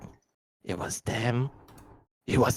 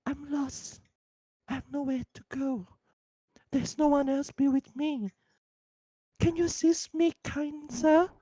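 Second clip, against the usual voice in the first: put-on pleading lost child; 12 semitones higher than usual. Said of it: "my particular child would be like".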